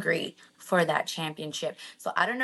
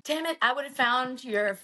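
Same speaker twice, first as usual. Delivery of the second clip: usual speaking voice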